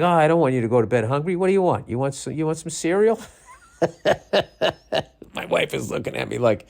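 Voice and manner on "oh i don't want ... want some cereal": higher, coaxing dad voice; pitched-up pushover pleading; doting softie parent. Retold or imitated. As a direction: fuss over a child, rising into eager offers. Softie dad caving to child.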